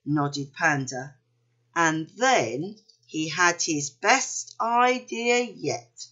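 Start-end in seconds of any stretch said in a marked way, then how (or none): none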